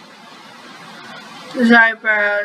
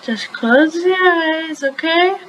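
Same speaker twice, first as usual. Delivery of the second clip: coaxing mother; 5 semitones higher, slower than usual. Acted. Mother coaxing twins before gift.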